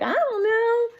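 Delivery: high, loud, rushed cartoon pig; Piggy shrugging to Elephant, high and fast. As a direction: blurt it out like a flustered pig. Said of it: "Pig's like".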